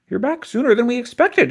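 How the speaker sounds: surprised and polite; principal voice. A narrator's reading. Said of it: "he said".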